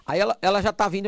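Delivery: ordinary talk, nothing marked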